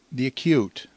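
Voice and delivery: ordinary talk, nothing marked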